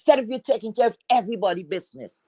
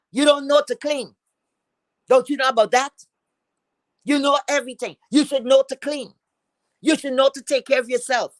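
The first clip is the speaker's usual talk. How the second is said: heated scolding; 6 semitones higher than usual. Real, not acted. Streamer berating a rival about her dirty home, heated scolding. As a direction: pitch up, pressing each accusation.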